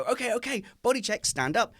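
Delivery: high, peppy body-check call; acted upbeat parent command; brisk parent routine. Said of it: "we go".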